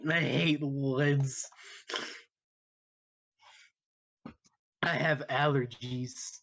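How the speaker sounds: slow, whiny allergic nerd; nerdy voice. Acted. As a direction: drag it out like a stuffy nerd. Player voices sneezy nerdy Spencer.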